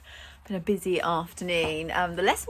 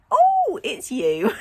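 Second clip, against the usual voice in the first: high, loud startled oh then settling; startled greeting to a client at the door; surprised oh; 9 semitones higher, 8 dB louder, much slower than usual. Retold or imitated. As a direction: jump up in pitch, caught out. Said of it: "And I was like".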